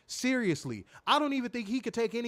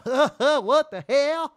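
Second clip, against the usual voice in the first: loud, high, slow mock-country bellow; laugh, then stretch it out big; hammed-up comedian caricature; Steve Harvey; 8 semitones higher, 10 dB louder, much slower than usual. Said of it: "talks like that".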